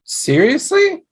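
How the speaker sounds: disbelieving, high rising Seriously; can't believe he means it; incredulous. Acted. Disbelieving reaction to the idea.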